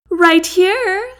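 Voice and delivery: high, loud sugary call; sweet voice. A narrator's reading. Lexi calling back to the captain, high sweet voice. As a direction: answer him sweetly across the crowd. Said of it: "she calls back in a sweet voice".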